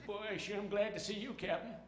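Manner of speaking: high, put-on old movie sidekick voice; Walter Brennan. Sergeant greeting his captain aboard the bomber, sidekick impression. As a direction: play a folksy western sidekick. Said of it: "Walter Brennan says".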